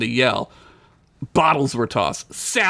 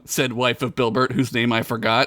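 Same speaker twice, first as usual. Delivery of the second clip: ordinary delivery, nothing marked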